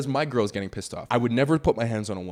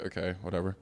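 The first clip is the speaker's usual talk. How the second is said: soft, low, offhand dismissal; retold indifference, low and quiet; muted shrug; 4 semitones lower, 9 dB quieter, faster than usual. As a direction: toss it away under your voice. Retold shrug at girls leaving.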